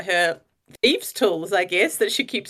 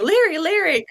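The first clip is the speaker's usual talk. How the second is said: higher, calling a name; 7 semitones higher than usual. Retold or imitated. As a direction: call for help. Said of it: "they're calling".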